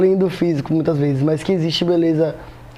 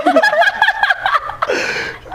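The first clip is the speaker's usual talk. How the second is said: high laugh. Real, not acted. Laughing mid-story.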